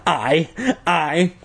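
breathless stammer; sputtering. A narrator's reading. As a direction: stumble out of breath. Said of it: "I sputtered breathlessly".

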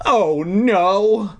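high wailing oh no. Acted. Deirdre wailing as the monster roars. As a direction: wail in dread. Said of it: "Deirdre wailed".

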